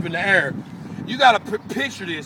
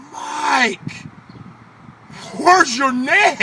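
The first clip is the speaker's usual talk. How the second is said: amazed question; 6 semitones higher, 3 dB louder, much slower than usual. Retold or imitated. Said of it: "I said".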